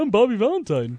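lightly sing-songy self-introduction; mock the manager's lilting voice; Bobby Valentine. Host posing as the manager, lightly sing-songy. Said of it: "like, sing-songy voice".

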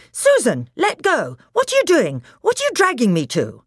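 high, loud, urgent complaint; indignant protest. Acted. Peter telling Susan to let go, indignant protest. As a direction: protest as if yanked by the arm.

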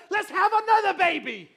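loud, high mock celebration; over-the-top joyful cheer; excited exclamation. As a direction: blurt it out in triumph. Acts out future excitement.

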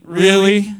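loud, low mock disbelief; emphatic doubting question; incredulous. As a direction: play God unconvinced, booming it a little. God's incredulous reply, acted.

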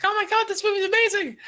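put-on very high, gushing kid voice; childhood gushing. Kid gushing about a movie, very high and excited. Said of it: "I was like".

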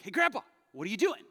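put-on eager kid. Child self barging in, retold.

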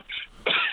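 a real cough mid-sentence. Real, not acted. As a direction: clear the throat with a cough. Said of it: "excuse me for my coughing there".

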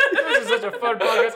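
high, loud laughing delivery. Real, not acted. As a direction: laugh right through it. Burst of laughter.

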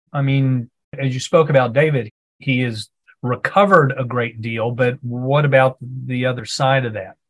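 ordinary talk

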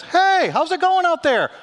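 high, loud, slow call across water; calling out from shore. Acted. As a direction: hail a fishing boat from the beach.

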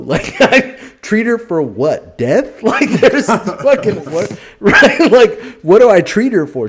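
high, loud laughing disbelief; cracking up while asking it; words bursting through laughter. Real, not acted. Absurd retelling, cracking up.

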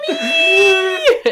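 high, loud wailing cry of Mommy; crying shout for mommy. Retold or imitated. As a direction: wail it like a hurt child. Crying out for mom.

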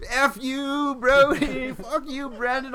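very high, loud hammed-up wail; retold tearful outburst, strained high; mock tearful cursing. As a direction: curse the pacer in an exaggerated crying squeal. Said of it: "Like, I'm crying".

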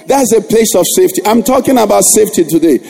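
earnest, slowed pastoral prayer over music. Acted. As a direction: lead the church in heartfelt prayer. Leads congregation in closing prayer.

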